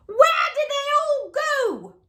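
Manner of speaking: high, loud frantic shout. Acted. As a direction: yell it across the farmyard. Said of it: "Gritch shouted to a duck".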